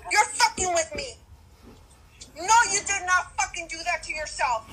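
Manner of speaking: ordinary talk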